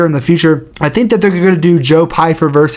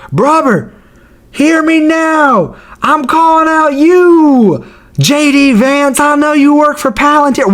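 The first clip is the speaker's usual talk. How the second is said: much higher, slow put-on voice calling someone out; mock callout; 10 semitones higher than usual. Fighter calls out the vice president, slow and much higher.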